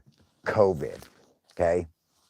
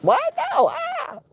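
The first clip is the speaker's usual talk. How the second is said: mock scared shriek; 3 dB louder than usual. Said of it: "She'll be like".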